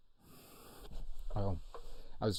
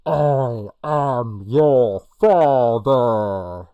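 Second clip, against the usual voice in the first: very loud, drawn-out slow declaration; villain impersonation; 3 semitones higher, 16 dB louder, much slower than usual. Acted. Host impersonating a film line for the quiz, booming and slow. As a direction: boom each word out slowly, hugely stretched. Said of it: "I hope you're loving all my impersonations".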